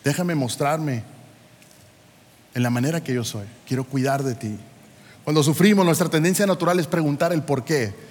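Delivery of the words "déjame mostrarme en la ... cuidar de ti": soft, low and tender; the Lord speaking softly to a tired child; gentle voice of God. Acted. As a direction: reassure like a caring father. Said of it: "El Señor te está diciendo".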